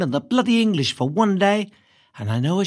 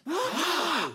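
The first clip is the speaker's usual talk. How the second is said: sharp, voiceless gasp; shocked gasp; 8 semitones higher, 7 dB quieter than usual. A narrator's reading. Classmates hearing the insult, shocked gasp.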